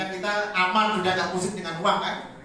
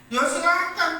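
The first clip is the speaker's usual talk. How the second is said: mock divine reply; 10 semitones higher, 4 dB louder than usual. God's mocking reply.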